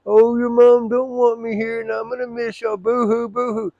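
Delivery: mock crying dad. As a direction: ham up dad's tearful plea to ridicule him. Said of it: "I said, "It's the same story".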